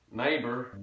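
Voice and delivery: "Neighbour" with wide vowels; Texan pronunciation. Shown as a demonstration. Teacher demonstrating Texan, "Neighbour" with wide vowels. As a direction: widen "Neighbour", American R. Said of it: "More wide vowels".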